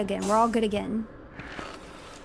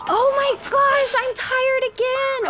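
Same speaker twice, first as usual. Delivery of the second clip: much higher, louder and drawn out; exasperated wail; 12 semitones higher, 4 dB louder, slower than usual. Real, not acted. Player dismayed at being tired again, high drawn-out wail. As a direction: wail it like you can't believe it's happening again.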